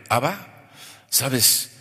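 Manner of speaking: ordinary talk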